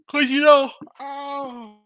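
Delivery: real yawn swallowing the words; high, stretched-out yawning voice. Real, not acted. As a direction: talk right through a big yawn. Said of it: "excuse me for my yawn".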